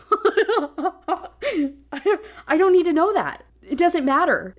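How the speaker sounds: fed-up laughing protest; laughing exasperation. Real, not acted. Podcast host laughing at a pointless sentence, exasperated.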